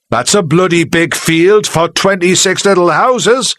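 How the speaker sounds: shouting. Acted. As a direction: shout it from the back of the hall. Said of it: "Charlie shouted from the back".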